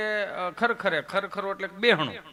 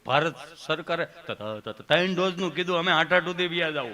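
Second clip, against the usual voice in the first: stammering patient in a lower voice; Gabho; 4 semitones lower than usual. Acted. Patient explaining himself to the nurse, stammering. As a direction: stumble and repeat the first sound. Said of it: "અહીંયા ગભો બેઠો હતો".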